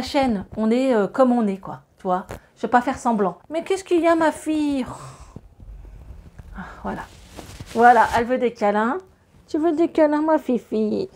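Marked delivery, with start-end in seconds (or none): 3.5-4.8 high, cooing question to the dog; talking to her dog. Real, not acted. 9.5-11.1 higher, cooing pet voice; tender voice for the dog wanting cuddles. Real, not acted.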